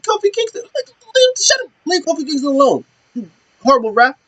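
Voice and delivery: very high, squeaky mock protest; exasperated scolding. Wrestler scolds referee, retold.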